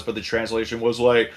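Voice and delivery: normal talking voice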